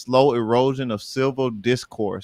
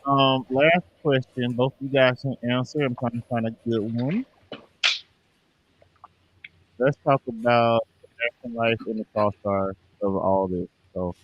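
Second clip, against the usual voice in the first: muffled, like through a wall. Real, not acted. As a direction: voice dull and covered, far from the mic. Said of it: "You sound muffled".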